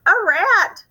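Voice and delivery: high, loud shriek of fright; old auntie startled by a creature; frightened cry. Acted. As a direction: shriek at the sight of a rat. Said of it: "she cries".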